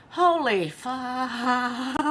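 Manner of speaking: cracking up mid word, high; holy, then helpless laughter; laughing in disbelief. Real, not acted. Shocked reading of abusive post.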